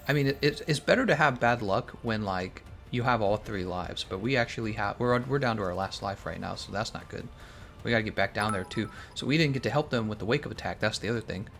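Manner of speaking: ordinary talk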